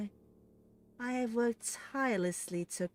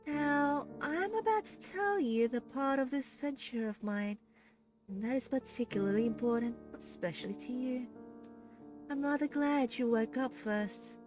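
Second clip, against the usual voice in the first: smug villainess explaining her scheme; smiling menace; 4 semitones higher, 3 dB quieter than usual. A narrator's reading. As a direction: savour every word. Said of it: "hear the voice smiling".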